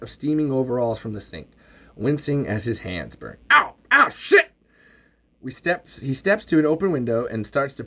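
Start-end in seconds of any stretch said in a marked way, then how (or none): 3.5-4.5 yelp of pain. Acted. Hands burning on overalls.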